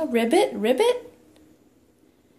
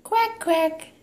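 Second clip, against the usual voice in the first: put-on playful sing-song toddler talk; reading to a toddler; 3 semitones higher than usual. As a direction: coax the toddler with animal sounds. Animal sounds game with toddler.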